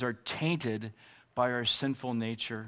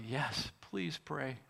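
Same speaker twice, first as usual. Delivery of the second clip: retold yell; 7 dB quieter, much slower than usual. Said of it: "And I yelled out".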